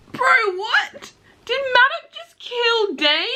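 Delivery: very high, slow astonished question; can't believe what she just read; high, drawn-out shocked gasp; stunned disbelief. Real, not acted. Shocked at a killing in book.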